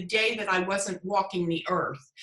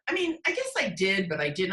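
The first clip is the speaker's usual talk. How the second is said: ordinary talk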